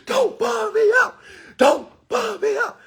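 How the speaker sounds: begging her not to ruin it; high urgent plea. Retold or imitated. Telling his kid's mom to stop, pleading high.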